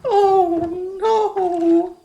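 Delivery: high, loud, laughing "oh no"; squeaky mock voice. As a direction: echo her in a silly squeak. High mock echo of partner.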